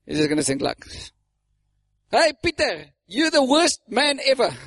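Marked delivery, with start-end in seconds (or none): 2.1-4.5 high, carrying mock heckle; calling across street. A stranger heckling Peter from across the street, calling out. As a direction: fling a playful insult from afar. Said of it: "on the other side of the street".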